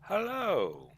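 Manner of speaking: high, drawn-out hello sliding down; deliberately awkward greeting. Pretend. Co-host answering his introduction on the show, deliberately awkward. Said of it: "You gotta make it awkward".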